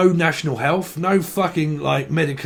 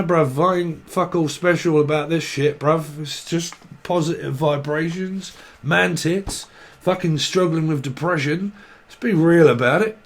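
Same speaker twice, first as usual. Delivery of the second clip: usual speaking voice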